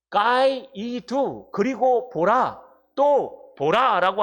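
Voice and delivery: very slow, each word drawn out; slow reading of the original. Shown as a demonstration. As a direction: lay out the Greek slowly, word by word. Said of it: "원문에는". (아주 천천히 또박또박)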